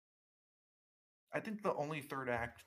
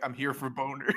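mock announcement; 9 dB louder than usual. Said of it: "Luke's like".

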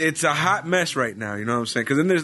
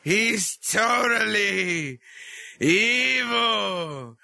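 ring announcer; 5 semitones higher, much slower than usual. Acted. Hyped villain intro.

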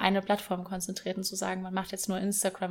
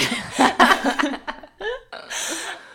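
high, loud burst of laughter; 8 semitones higher, 7 dB louder than usual. Real, not acted. Laughing at the Ventilator joke.

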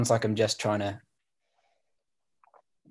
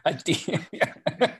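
put-on German accent; 5 semitones higher than usual. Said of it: "describe code in a German accent".

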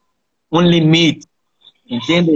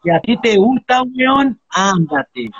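put-on light Chilean accent, higher pitch, mom voice; 7 semitones higher, slower than usual. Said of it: "mi mamá me decía". (imitando a la madre chilena, con acento chileno leve y voz más aguda)

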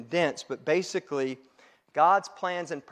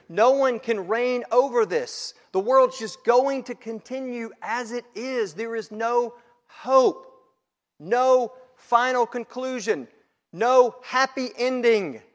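higher, louder cry of hopelessness; impassioned preaching; 8 semitones higher, 6 dB louder than usual. Acted. Preacher voicing John's despair to the congregation, high and forceful. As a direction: drive home each bleak phrase.

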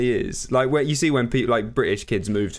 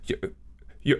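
stuttering, baffled Prime Minister; Boris Johnson; faster than usual. Acted. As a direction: stammer in halting disbelief. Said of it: "do your fucking Boris Johnson accent".

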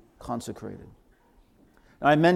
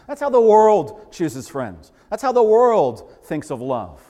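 higher and louder, pointed questions; preaching at full force; 7 semitones higher, 7 dB louder than usual. Acted. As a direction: hammer the world's selfish questions. Mocking worldly questions, preaching.

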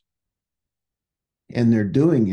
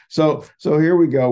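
higher and louder, bouncing back in; energetic restart; 6 semitones higher, 6 dB louder than usual. Real, not acted. Back after fixing the squeak, louder and higher with energy. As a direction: pick it back up with a burst of energy. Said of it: "take care of business and get it done".

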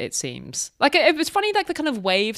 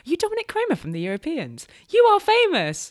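high, fast gush rising to a louder cry; starstruck fan; 11 semitones higher, 3 dB louder, faster than usual. Retold or imitated. Listener approaches random man in Munich, mock starstruck excitement. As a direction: imitate a thrilled fan spotting someone famous.

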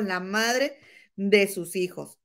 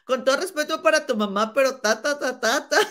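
imitated retort; 6 semitones higher than usual. Acts out Mayeli's reply, amused.

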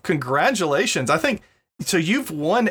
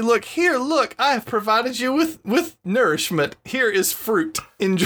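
higher-pitched student presenting a gift; imagined eager student; 7 semitones higher than usual. Acted. Podcaster playing a student offering fruit to teacher, eager presenting.

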